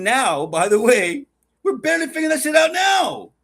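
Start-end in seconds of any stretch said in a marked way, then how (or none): 1.6-3.3 loud, higher, fed-up outburst; exasperated shout. Real, not acted. Ranting to his co-host about robots, a loud exasperated shout. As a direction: blurt it out in disbelief.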